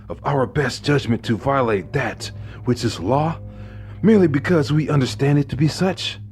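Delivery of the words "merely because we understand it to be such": ordinary delivery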